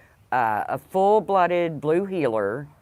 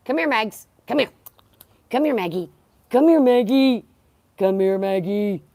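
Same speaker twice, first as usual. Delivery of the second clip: calling the dog; 6 semitones higher, 5 dB louder than usual. Real, not acted.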